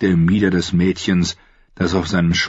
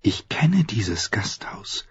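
whisper; 3 semitones higher, 6 dB quieter, slower than usual. Acted. Arya recognizes the inn.

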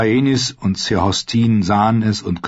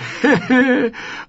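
put-on high, falling cackle from an old lord; old man cackle. A narrator's reading. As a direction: cackle at your own dirty joke. Old lord laughs at own joke.

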